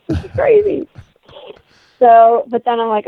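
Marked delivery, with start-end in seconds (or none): 0.1-0.9 high mock disbelief. Mock reaction while walking away.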